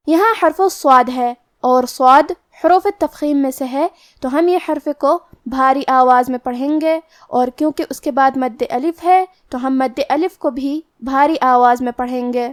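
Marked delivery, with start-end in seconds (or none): none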